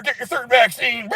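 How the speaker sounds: high, slow mocking shout; mock nagging. Mocking the people urging vaccines, in a high drawn-out shout. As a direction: parody the pushy vaccine crowd.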